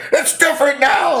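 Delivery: high loud mock shout; protesting husband. Husband yelling back at his wife, high loud protest. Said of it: "He was like".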